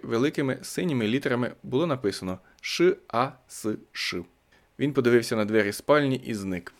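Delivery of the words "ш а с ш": each letter of «Ш А С Ш» read apart, slowly; spelled out slowly. A narrator's reading. Reading the shirt pocket lettering, spelled out slowly.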